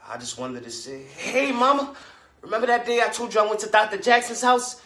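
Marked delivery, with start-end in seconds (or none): 1.0-4.8 high, loud and bubbling over; eager child calling Mama. Acted. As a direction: pour it out to Mama like an excited kid. Child's eager tale to mother.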